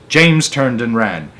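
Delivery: ordinary delivery, nothing marked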